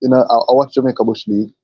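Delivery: ordinary delivery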